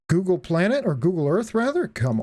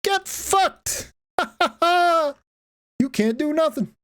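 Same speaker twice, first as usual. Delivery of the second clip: mock yell at Yankees; 12 semitones higher, slower than usual. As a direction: heckle the boats from shore. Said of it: "You can, like, yell at the Yankees".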